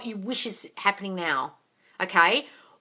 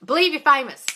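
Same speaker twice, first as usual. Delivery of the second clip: a loud, higher bossy order; mock command; 8 semitones higher, 9 dB louder than usual. Shown as a demonstration. Host shows how absurd ordering a feeling sounds, loud mock command. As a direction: bark it like a silly command. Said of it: "you can't force a feeling".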